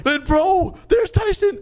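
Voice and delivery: very high, startled outburst; shocked exclamation. Real, not acted. Player suddenly spotting Tyson in the bunker, shocked.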